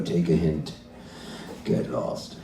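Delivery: very low, soft husband puppet; deep, muted dismissal; man's dummy voice. Acted. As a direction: send him off in a deep voice. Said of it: "What about a voice like this?".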